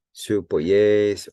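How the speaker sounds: polles slow and loud on its final syllable; slow demonstration of polles with a heavy ending; stress on last syllable. Shown as a demonstration. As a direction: hit the end of polles and hold it. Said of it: "it's always on that last syllable".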